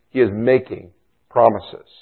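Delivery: ordinary talk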